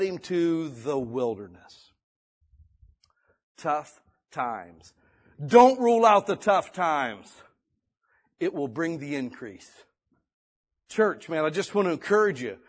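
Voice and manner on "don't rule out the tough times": emphatic. Real, not acted. Preacher emphasizing point.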